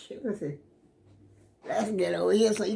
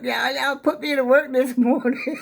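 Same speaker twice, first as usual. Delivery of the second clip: higher, laughing through the words; 7 semitones higher than usual. Real, not acted. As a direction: chuckle at yourself after the work. Out of breath, laughing at herself.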